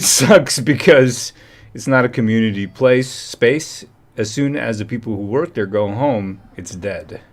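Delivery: ordinary talk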